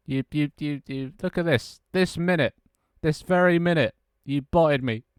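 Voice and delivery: hammed-up betrayed scolding; mock accusation.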